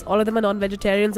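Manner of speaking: ordinary talk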